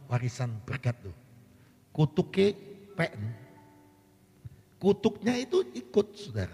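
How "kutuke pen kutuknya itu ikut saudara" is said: Kutuknya punched high and loud; stress on kutuk. Real, not acted. Preacher warning the congregation, Kutuknya hammered high. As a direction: hit Kutuknya hard and high.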